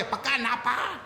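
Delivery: laughing stage prompt; prompting whisper-ish. Retold or imitated. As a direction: hiss the line from behind the curtain. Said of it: "script વાંચે".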